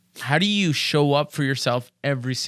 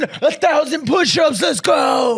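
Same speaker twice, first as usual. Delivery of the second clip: loud, high gym-bro yell; hype shout; 9 semitones higher, 5 dB louder, slower than usual. Pretend. Host shouting to fire up a lazy gym-goer. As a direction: bark it like a pumped coach.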